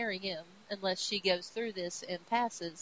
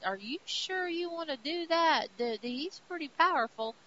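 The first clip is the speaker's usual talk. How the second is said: higher pitched, doubtful adviser voice; worried counselor; 7 semitones higher than usual. Acted. Counselor doubting Odin's order, higher anxious voice. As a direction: question the king nervously. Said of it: "the counselor that is with him is like".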